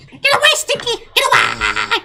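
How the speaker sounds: high squeaky critter voice; squeaky creature voice. Acted. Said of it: "incoherent chirping".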